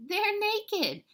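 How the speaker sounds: high, loud mock-giggly delivery; giggling little sister. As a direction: squeal it with a touch of naughty glee. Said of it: "Jilly Boo, giggling".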